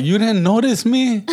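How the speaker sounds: incredulous. Retold or imitated. Asking her in disbelief, high and loud. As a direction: pitch it up, amazed she missed him. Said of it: "And I'm like".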